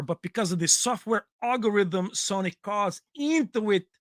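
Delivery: reading a TV spec in a lightly put-on foreign accent; a mild mock accent for a tech pitch; hint of a foreign accent. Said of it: "I'm gonna do this one in accent".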